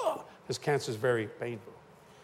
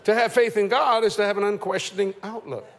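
louder, higher proclaimed statement; emphatic preaching; 5 semitones higher, 6 dB louder than usual. Shown as a demonstration. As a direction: preach the line at full force. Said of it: "this last statement".

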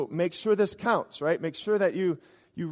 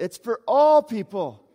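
all punched, louder and higher, slow; emphatic call; 5 semitones higher, 9 dB louder, slower than usual. Real, not acted. Preacher urging his flock, all people, loud and slow. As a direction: hit all with force and lift. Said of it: "underline it".

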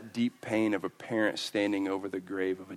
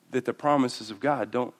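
ordinary talk, nothing marked

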